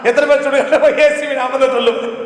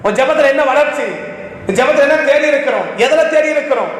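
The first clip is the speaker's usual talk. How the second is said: ordinary talk, nothing marked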